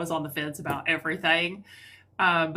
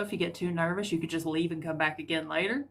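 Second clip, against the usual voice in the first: ordinary delivery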